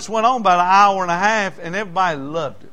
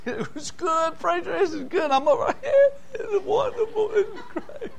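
much higher, crying and laughing with joy; overjoyed friend half-sobbing, half-laughing; excited Bobby; 13 semitones higher, 5 dB quieter than usual. Retold or imitated. Bobby nudges the preacher in church, giddy teary high voice. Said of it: "He, he reached over and hit me on the leg".

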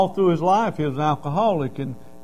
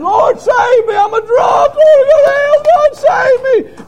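much higher, loud, sobbing shout; crying out to God; 13 dB louder than usual. Retold or imitated. Drunk man at the altar crying out for salvation, sobbing shout. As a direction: beg God through sobs at full voice. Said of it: "He was crying".

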